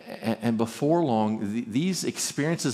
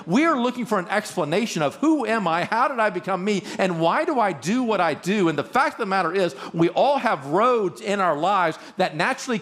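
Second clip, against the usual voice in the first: raised, driving sermon voice, higher and pushing; full-force pulpit preaching; impassioned preaching; 6 semitones higher than usual. Acted. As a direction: preach it with rising urgency to the congregation.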